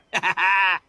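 booming, hearty laugh; loud laugh. Acted. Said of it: "What a noisy guy".

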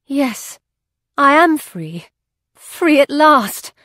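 high-pitched exclamation of relief; exclaiming. A narrator's reading. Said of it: "and she exclaimed".

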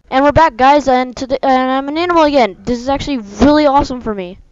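loud, high, fast intro; bursting back in, fired up; excited. Real, not acted. Energetic intro after break.

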